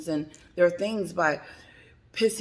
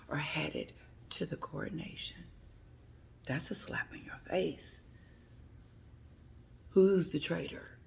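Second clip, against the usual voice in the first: soft conspiratorial whisper; 9 dB quieter than usual. Real, not acted. Telling viewers the gossip, whispering. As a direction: lean in and whisper it.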